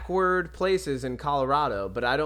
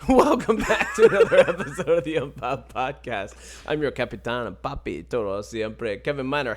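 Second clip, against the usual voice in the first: louder show-opener voice, laughing; podcast host intro; 3 dB louder than usual. Acted. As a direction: open the show with a flourish.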